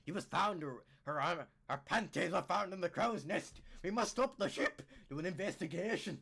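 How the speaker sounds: low, melodramatic Scottish report; hammed-up Scottish detective; mock Scottish accent. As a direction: play the urgent investigator in Scottish accent. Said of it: "the Scottish accent".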